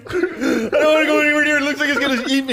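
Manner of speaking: high squealing fake panic; mock scared squeal. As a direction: squeal like a frightened kid. Mocking scared caller, high voice.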